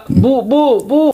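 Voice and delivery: ordinary talk, nothing marked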